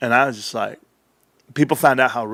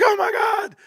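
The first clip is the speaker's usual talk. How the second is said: mock amazement. Laughing at his old excitement.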